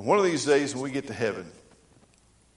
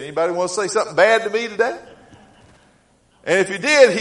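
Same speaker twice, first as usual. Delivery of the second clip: fast, higher, louder taunting invite; gleeful, fast street challenge; eager challenge; 7 semitones higher, 8 dB louder, faster than usual. Retold or imitated. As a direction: dare the whole street gleefully. Caller imagines being rich.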